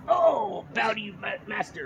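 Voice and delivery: high, soft, hammed-up grovelling; small, high-pitched mock-reverent voice; mock submission. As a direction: play a beaten man bowing to his master. Acts out floored victim submitting.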